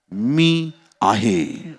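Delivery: very slow, drawn-out words; solemn emphasis. Acted. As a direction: let each word stretch out with weight. Key words repeated for emphasis.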